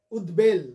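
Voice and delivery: উদ্বেল said firmly as ud-bel; b sounded. Shown as a demonstration. Teacher repeating the corrected word, ud-bel with b. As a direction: press on the ব in উদ্বেল. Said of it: "বফলার উচ্চারণ হবে".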